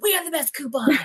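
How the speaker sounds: high, bright salesy pitch; mock ad voice. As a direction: sell it like a cheesy ad. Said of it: "We're gonna be like".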